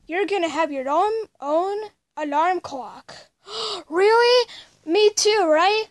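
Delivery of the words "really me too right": gasp, then a high, loud really; thrilled by the surprise gift; excited gasp. Acted. Junior hearing he gets a new alarm clock, excited.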